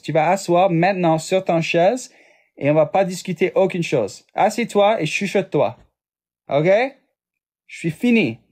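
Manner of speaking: high-pitched, scolding mock outrage; French sass. Streamer performing sass in French on request, sassy scolding. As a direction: tell them off with playful, high-pitched sass. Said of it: "Can you give us sass in French?".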